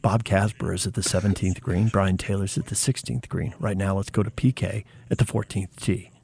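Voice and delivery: low hushed golf commentator; golf announcer voice. Acted. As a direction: murmur it like a TV golf broadcast. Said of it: "I just go to my golf voice".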